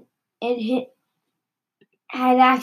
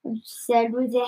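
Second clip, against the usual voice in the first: usual speaking voice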